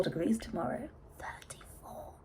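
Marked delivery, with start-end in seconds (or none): 1.2-2.1 breathy whisper of disbelief; disbelieving whisper. Real, not acted.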